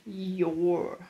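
"your" said as one short syllable, the contraction of you are; teacher models "your" slowly as a single blended sound; contraction you're. Shown as a demonstration. Teacher shows students the contraction, carefully sounded. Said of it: "E de you are, fica".

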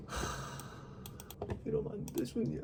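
whispered sigh and grumble to herself; under breath. Real, not acted. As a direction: mutter it half aloud, barely voiced. Muttering to self over grades.

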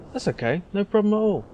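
sickly-sweet before the blow; mock sarcastic. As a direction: boss feigning kindness to a late worker. Boss about to fire Ryan.

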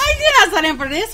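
fast echo. Real, not acted.

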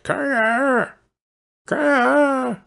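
high, drawn-out raven caw; raven's croak. A narrator's reading. Pinched raven cries out its prophecy, high croaking caw. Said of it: "it croaked and made a noise like".

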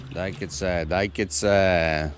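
normal talking voice

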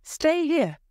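high-pitched urgent warning; crying out. A narrator's reading. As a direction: cry out a warning, voice jumping high. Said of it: "he cried".

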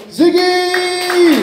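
high, long drawn-out name call; stage intro holler, last vowel stretched; announcer's call. Real, not acted. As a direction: bring on the act with a soaring, held shout. MC calls the next act on.

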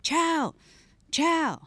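short high-pitched call. Retold or imitated. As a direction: call it out down the cell block. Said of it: "I just called for chow".

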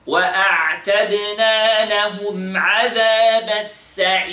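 measured tajweed recitation with long vowels; Quran recitation. Acted. Teacher reciting the verse for students to copy. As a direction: recite it like the teacher's model reading.

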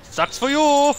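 high, loud jeer at an opponent; gloating taunt. Real, not acted. Player jeers at an unlucky opponent, gloating loudly.

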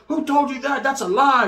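put-on high-pitched protesting girlfriend; imitated outraged denial; mock indignant denial. Acted girlfriend denying the accusation.

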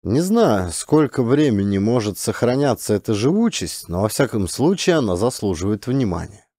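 plain reading voice

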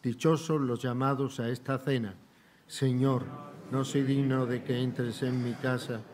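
ordinary talk, nothing marked

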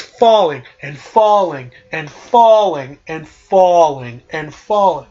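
slow, repetitive, stretched-out telling; drawn out. Retold or imitated. Narrator tells of Roxas's endless fall, drawn out. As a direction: stretch every "falling" as if it never ends.

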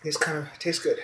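normal talking voice

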